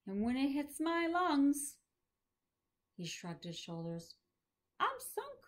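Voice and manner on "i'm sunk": put-on high, resigned old man; a shrugging, high "I'm sunk"; old professor voice. Voicing Maury.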